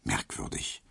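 breathy whisper of "Merkwürdig"; whispered aside. A narrator's reading. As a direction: murmur it to yourself. Narrator wry aside.

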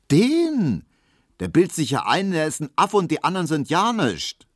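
hoch und laut, voller Verachtung; verächtlich. A narrator's reading. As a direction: schnaub ihn höhnisch ab. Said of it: "meinte Hedwig verächtlich". (Hedwig verspottet den Kollegen, höher und lauter, verächtlich)

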